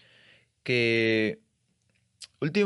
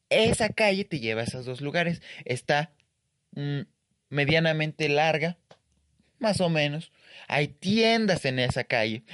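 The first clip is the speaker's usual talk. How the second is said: ordinary delivery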